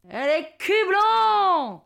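high, slow southern French holler; hammed-up southern French accent. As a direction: bellow a bad imitation of Marseille accent. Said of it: "Je prends mon plus bel accent du Sud". (faux accent du Sud, crié et traînant)

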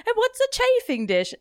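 hammed-up bad British accent, pitched high; mock a British leading man badly; a self-mocking British accent; terrible British accent. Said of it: "That's my terrible British accent".

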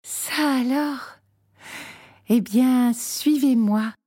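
mischievous. A narrator's reading. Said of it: "dit Petrouchka malicieuse".

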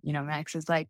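usual speaking voice

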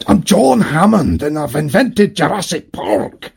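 put-on slow, lightly done Scottish accent; mimicking Attenborough's Scottish accent as Hammond, slow. Slow mock Scottish accent, lightly done. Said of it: "he hasn't got an inkling of a Scottish accent".

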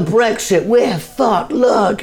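high, panicky doom-monger; doom cry of a comic on Brexit; mock panic. Comic mocking Brexit despair in a high, panicky voice. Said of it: "it's like".

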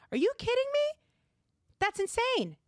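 high, loud disbelief; incredulous outburst. Real, not acted. Host reacting to Elihu's claim, high incredulous outburst. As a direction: cry out at the absurdity.

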